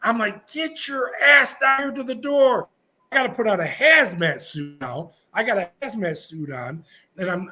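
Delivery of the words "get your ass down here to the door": high, slow imitation of a guard's yell; drawn-out, high-pitched retold order. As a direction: stretch out a mock commanding yell. Guard yelling over screaming inmate.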